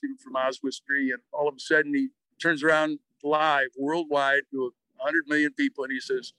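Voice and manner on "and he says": ordinary talk, nothing marked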